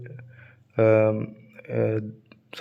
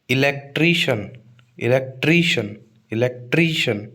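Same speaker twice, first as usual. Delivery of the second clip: Electrician with a long, weighted tree; stress on tree. Shown as a demonstration. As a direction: lean on TREE in electrician. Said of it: "TRI पे stress आएगा". (Electrician के tree पर ज़ोर)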